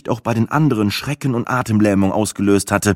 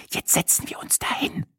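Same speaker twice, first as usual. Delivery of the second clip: higher, soft conspiratorial whisper; 11 semitones higher, 7 dB quieter than usual. A narrator's reading. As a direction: whisper it so nobody at the table hears. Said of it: "tuschelte Sina". (geflüstert, verschwörerisch)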